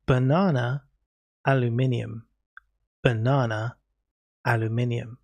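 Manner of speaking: British banana, aluminium; British accent. Shown as a demonstration. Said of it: "do Kaiser a favor and say banana".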